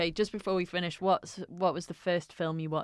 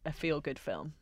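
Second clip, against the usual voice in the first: usual speaking voice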